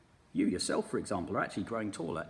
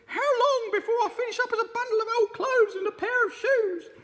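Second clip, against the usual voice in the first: put-on much higher, louder old woman's voice; a fretful old wife squawking high; Mrs Twit; 12 dB louder than usual. Mrs Twit's dialogue.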